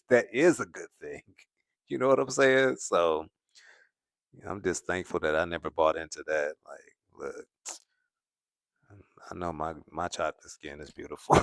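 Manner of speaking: normal talking voice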